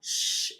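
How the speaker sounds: a soft, breathy hushing ش with no voice; just the bare hissed sh sound, ش. Shown as a demonstration. Teacher showing pupils how to say ش. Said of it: "اس اتش وانطقها".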